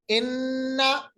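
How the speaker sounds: slow इन्ना with the noon held in the nose; nasal ghunna. Shown as a demonstration. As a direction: hum the doubled noon of इन्ना through the nose. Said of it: "नून पे तशदीद तो गुन्ना होगा".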